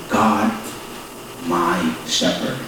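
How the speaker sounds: God, my shepherd, soft and slow, falling to rest on shepherd; settled statement. Shown as a demonstration. Pastor repeating the Psalm opening as quiet statement. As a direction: let my shepherd sink low and certain. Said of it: "it's a statement".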